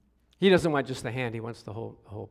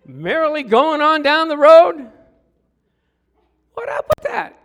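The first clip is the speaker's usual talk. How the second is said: much higher and louder, laughing disbelief; mock outrage; 11 semitones higher, 11 dB louder than usual. Pastor seeing his son driving his car, in loud mock disbelief. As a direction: act out the shock of seeing your car go by.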